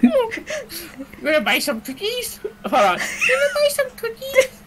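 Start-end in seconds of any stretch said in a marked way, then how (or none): 0.0-2.4 high, slow cookie-seller voice; drawn-out, high little sales pitch; Girl Scout. Pretend. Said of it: "looks like a freaking Girl Scout". 3.0-4.6 very high squeaky cookie seller; Girl Scout. Pretend. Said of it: "looks like a freaking Girl Scout".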